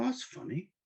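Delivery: mock woman's voice. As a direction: play the new woman self, puzzled. Finds he has a woman's body.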